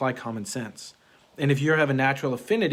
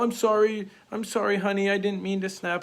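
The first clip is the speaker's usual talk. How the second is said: higher, hammed-up sorry-honey voice; mock sheepish apology; 7 semitones higher than usual. Husband mocking a sheepish apology to his wife, higher mock pleading. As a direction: imitate a guilty husband apologizing, a bit pathetic. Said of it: "he goes in the whole".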